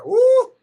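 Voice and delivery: high sudden whoop; excited whoop. Real, not acted. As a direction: blurt a cheer. Sudden excitement mid-sentence.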